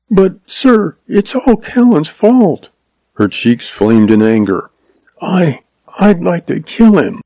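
put-on a young schoolteacher, earnest and upset; young woman's voice. A narrator's reading. Lois voiced by narrator.

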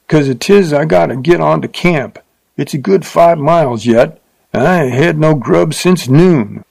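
a rude rider in a hurry to get to camp; rough cowhand, impatient. A narrator's reading. Rough man's dialogue.

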